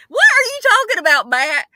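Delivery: startled outburst. Real, not acted. Reacting to her co-host's odd remark, startled disbelief. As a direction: blurt it out in disbelief.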